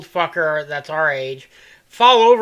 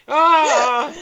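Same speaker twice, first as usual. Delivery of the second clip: mock startled yell; 7 semitones higher, 6 dB louder than usual. Dude rhinoed while peeing.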